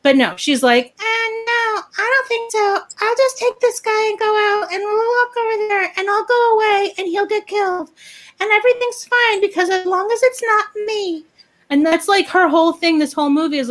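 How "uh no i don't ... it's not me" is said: high, sing-song put-on voice; high-pitched mock innocence; mocking imitation. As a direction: mimic an oblivious woman way up high. Mocking the woman's selfish reasoning.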